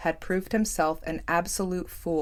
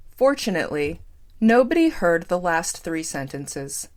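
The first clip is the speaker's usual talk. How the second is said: ordinary delivery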